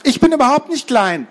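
put-on high, indignant little boy; indignant protest. Boy objects to being called smallest, indignant.

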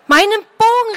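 put-on girl calls to Lien, urgent; calling out. A narrator's reading. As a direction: cry out for the bow. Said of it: "rief das Mädchen".

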